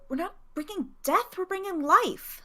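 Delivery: high, rising defiant retort; young teen talking back to the midwife; defiant child. Acted. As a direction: stamp your foot and answer back. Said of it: "I stomp my foot and I go".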